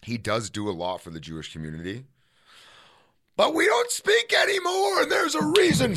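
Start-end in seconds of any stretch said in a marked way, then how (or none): 3.4-5.9 shouting. Real, not acted. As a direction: declare it dramatically. Dramatic beef declaration.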